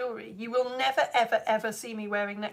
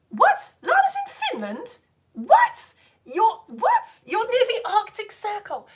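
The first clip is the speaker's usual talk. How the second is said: stunned surprise; 9 semitones higher, 5 dB louder, slower than usual. Real, not acted. Reads a surprising comment.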